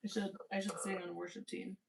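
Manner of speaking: ordinary talk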